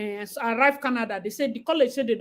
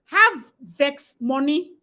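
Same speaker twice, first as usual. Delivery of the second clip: slow emphatic; 4 dB louder, much slower than usual. Shown as a demonstration.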